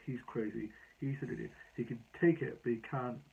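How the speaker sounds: usual speaking voice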